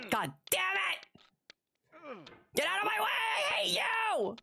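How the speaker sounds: high, strained rage at the game; real gamer rage, high and strained; high frustrated yelling. Real, not acted. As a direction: scream it at the screen. Raging at the game.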